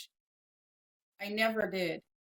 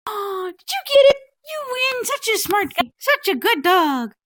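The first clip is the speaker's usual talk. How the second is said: very high, loud gushing praise; excited dog praise; 12 semitones higher, 14 dB louder than usual. Real, not acted. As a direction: squeal your praise at a clever dog.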